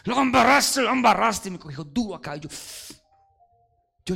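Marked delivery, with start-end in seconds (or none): 0.0-1.5 high, loud furious rebuke; harsh scolding. Retold or imitated. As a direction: bark it at him like a stern leader. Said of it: "me habló durísimo".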